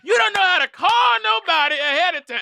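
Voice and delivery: hammed-up loud rant; mock rant. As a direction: rant like you're fed up. Impersonating friend ranting.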